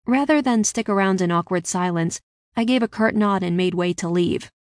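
usual speaking voice

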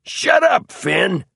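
very high, loud snap; snapped rebuke. A narrator's reading. Man with the knife silencing his brother, snapped rebuke. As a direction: snap at him, voice leaping high. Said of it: "snapped the man with the Bowie knife".

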